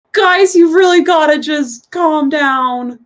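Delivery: high, slow, drawn-out youthful voice; slow high-voiced young bath attendant; young non-binary attendant. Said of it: "Young non-binary person. And says".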